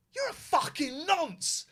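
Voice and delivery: high, slow, loud sneer; mock sneering yell; snarled insult. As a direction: spit the insult out. Aggressive man insulting.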